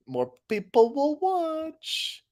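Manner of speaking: slow drawn-out sales line. Real, not acted. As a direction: stretch each word slowly.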